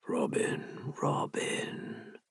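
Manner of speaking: breathy whispered name, twice; hushed urging whisper; urgent whisper. Acted. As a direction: whisper his name close and pleading. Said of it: "Hart urged".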